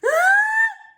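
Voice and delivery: loud high gasping inhale; shout-gasp before a sneeze; big sneeze gasp. Acted. Gerald gasping hard as a sneeze builds.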